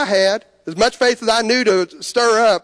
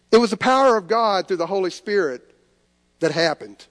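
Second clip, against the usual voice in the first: normal talking voice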